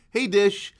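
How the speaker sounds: loud high shout at the dish; calling out loudly. Pretend. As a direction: bark it like a command. Yelling at the satellite dish.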